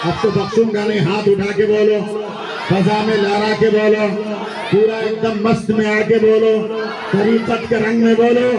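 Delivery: rousing preaching. Real, not acted. Preacher urging the gathering to shout praise, rising cry. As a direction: whip the crowd up to praise.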